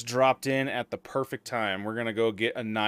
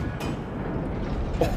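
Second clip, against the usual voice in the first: shaky laugh after a fright; breathless laugh as the fear drains; relieved laughter. Real, not acted. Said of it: "that scared the crap out of me".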